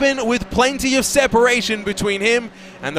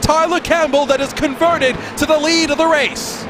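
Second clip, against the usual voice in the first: higher-pitched, urgent race-start commentary; announcer riding the action at a raised pitch; live race play-by-play; 4 semitones higher than usual. Real, not acted. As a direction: call the opening lap with sustained excitement.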